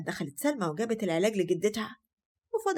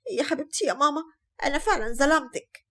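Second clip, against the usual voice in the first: mild crying; 6 semitones higher, 3 dB louder than usual. Acted. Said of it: "وفضلت تعيط".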